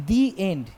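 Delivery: "the" drawn out as dee before end. Shown as a demonstration. Teacher repeating the example for the class, "the" said dee. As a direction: say "the" with a long ee. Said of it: "উচ্চারণ করি the".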